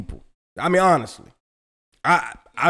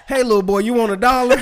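put-on raised, louder sleazy offer to a kid; creepy old man; 7 semitones higher, 7 dB louder than usual. Joking about getting old, mock creepy stranger calling a kid. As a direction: ham up a creepy stranger luring a boy.

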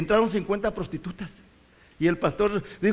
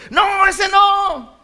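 high, slow, loud shouted refusal; mock demon refusal; 10 semitones higher, 8 dB louder, much slower than usual. Retelling a deliverance, a spirit shouting it refuses to leave. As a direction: ham up a stubborn demon crying no.

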